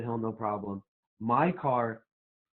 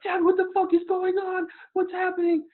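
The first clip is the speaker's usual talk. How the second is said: put-on high, loud, panicky kid voice; boy in the back seat panicking at his dad, screaming; panicked kid scream; 8 dB louder than usual. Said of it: "The kid behind me is screaming and freaking out".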